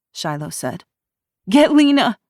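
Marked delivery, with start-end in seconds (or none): 1.5-2.2 high, loud urgent cry; frantic cry. A narrator's reading. Ruby to Shiloh, frantic cry. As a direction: shout for help. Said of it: "Ruby cried".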